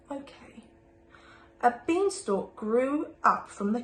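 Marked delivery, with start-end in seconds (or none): 0.1-0.6 soft unvoiced whisper. Wolf quietly agreeing to change the ending, whispered. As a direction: breathe it, barely there.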